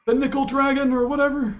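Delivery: put-on high wheezy old man voice; rambling old man, confused; breathless old person. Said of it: "that wheezy old man laugh".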